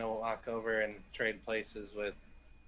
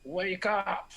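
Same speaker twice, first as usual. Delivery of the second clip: sudden high-pitched cry to wake someone; sudden wake-up call; 8 semitones higher than usual. Acted. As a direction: jolt a sleeper awake, pitched way up. Rousing an unconscious captive.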